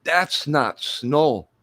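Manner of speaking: put-on Irish accent, Crocodile Dundee line; bad Irish take on Australian; mock Irish accent. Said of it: "I'm still talking like an Irishman".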